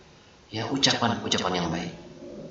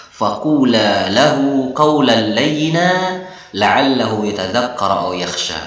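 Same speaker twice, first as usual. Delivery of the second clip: slow, measured Quranic chant; Quran recitation; 2 semitones lower, slower than usual. Acted. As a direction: recite the verse slowly and melodically. Quran verse quoted in lesson.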